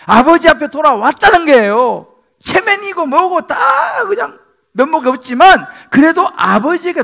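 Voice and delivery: 열정적인 설교 절정; impassioned preaching. Real, not acted. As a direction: press the key point on the church. Preaching at full force.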